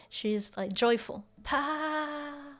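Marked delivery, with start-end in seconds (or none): 1.4-2.5 beaming pleased sound. Retold or imitated. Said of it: "she looks very pleased".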